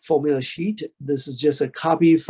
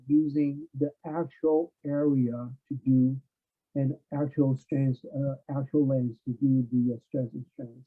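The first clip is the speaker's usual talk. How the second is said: muffled, like a covered mic; 6 dB quieter than usual. Real, not acted. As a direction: sound as if through a wall. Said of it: "You sound muffled, like something's covering your mic".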